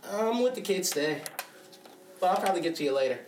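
lightly pitched put-on woman voice; female voice. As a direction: play it as the woman, brushing him off. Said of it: "I put on my best female voice".

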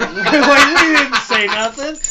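loud, high mock Italian accent; attempted Italian accent. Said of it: "I can't do an Italian accent".

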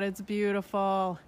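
ordinary delivery

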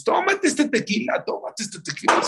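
high, insistent party egging-on; acted urging. Wedding guests urging tequila, high insistent egging-on. Guests urge a man to drink.

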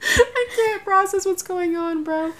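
high, slow mock-crying wail; acted-out helpless whimper; overwhelmed whine. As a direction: whine it high and drawn out, near tears. Overwhelmed at the register, retold.

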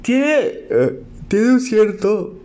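slow, stretched through a yawn. Real, not acted. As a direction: speak while yawning. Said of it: "de bostezar".

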